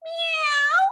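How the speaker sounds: high long meow; high drawn meow; cat meow. Acted. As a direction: mew like a kitten. Imitates cat.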